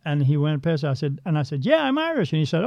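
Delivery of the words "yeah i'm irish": raised, cheery retold answer; a light high sing-song yes; mock bright reply. To the running spirit, a high cheery answer.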